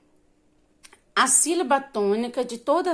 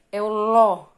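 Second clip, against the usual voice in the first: "ló" punched loud as the answer; stress on LÓ; 8 dB louder than usual. Shown as a demonstration. Teacher giving children the strong syllable, "ló" stressed loudly. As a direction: hit "ló" hard. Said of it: "Qual é a sílaba mais forte".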